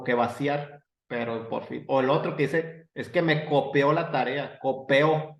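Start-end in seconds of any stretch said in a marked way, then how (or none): 2.9-5.4 "copió" dicho "co-pe-o", con e en vez de i. Shown as a demonstration. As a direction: di "copió" como "copeo". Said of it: "así con E". (imitando el error, "copió" como "copeo")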